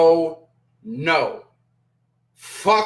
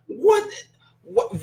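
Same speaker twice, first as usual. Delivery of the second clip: a high, rising yelp of disbelief; a startled squeak of "what?"; shocked what; 13 semitones higher than usual. Real, not acted. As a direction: jolt up in sudden shock. Reacting to Max's comment.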